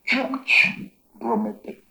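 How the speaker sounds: Chuch Romete drawn out slow, high and loud, Chuch clipped; careful demo word. Shown as a demonstration. Teacher shows the class the true pronunciation, slow and high. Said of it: "Dense cuenta cómo lo voy a pronunciar".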